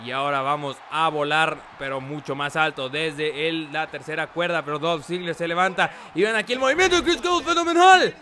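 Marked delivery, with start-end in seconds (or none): 6.1-8.1 wrestling commentator. Acted. Hyped wrestling play-by-play.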